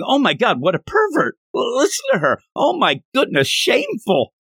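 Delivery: high, scandalized gasping voice; shocked henchmen. Retold or imitated. Henchmen appalled at the girl, high scandalized squeal. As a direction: clutch your pearls, high and appalled.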